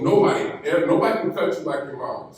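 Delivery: ordinary talk, nothing marked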